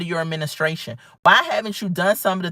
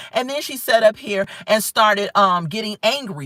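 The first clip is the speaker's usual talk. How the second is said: ordinary talk, nothing marked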